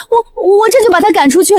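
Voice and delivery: normal talking voice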